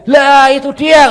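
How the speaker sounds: high, loud, drawn-out exclamation; excited mom. Retold or imitated. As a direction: pounce on it as the delighted mother. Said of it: "kata mami saya".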